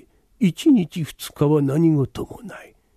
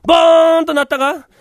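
bell boom shout; 9 semitones higher, 7 dB louder than usual. Acted. 怪談の鐘を先回りして叫ぶ.

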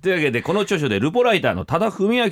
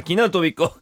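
normal talking voice

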